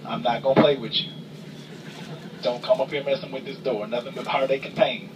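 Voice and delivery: ordinary talk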